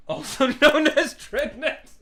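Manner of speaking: slow, high, cracking up mid-phrase; can't hold back the laugh; laughing through it. Real, not acted. Streamer laughing at his own word to chat, breaking up. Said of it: "I'm trying not to laugh at dreadnecks".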